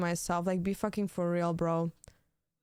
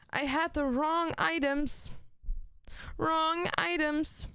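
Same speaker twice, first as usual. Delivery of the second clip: high slow whine; 8 semitones higher, slower than usual. Acted.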